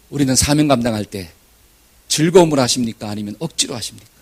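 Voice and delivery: ordinary talk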